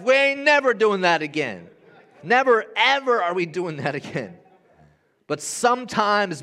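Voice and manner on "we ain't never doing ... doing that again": emphatic vow. Retold or imitated. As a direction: swear it off forcefully, retelling it to the crowd. Retelling firm vow to wife.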